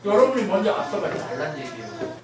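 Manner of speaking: loud, high-pitched sermon delivery; impassioned preaching. Real, not acted. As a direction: drive the point home at full force. Preaches at full force.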